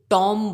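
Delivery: टॉम like the name Tom; wrong pronunciation. Shown as a demonstration. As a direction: open "o" in टॉम. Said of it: "जो कि गलत है".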